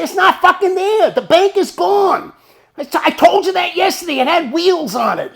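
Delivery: loud, high-pitched insistence; worked-up I-told-you-so; exasperated shouting. Retold or imitated. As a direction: yell it at a friend who won't listen. Retold argument about vanished bank.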